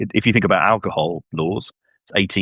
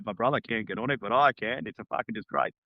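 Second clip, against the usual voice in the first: an imitated Australian teen gloating to a news reporter; Australian accent; 3 semitones higher, 9 dB quieter than usual. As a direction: put on a jokey Australian accent. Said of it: "don't do the Australian accent".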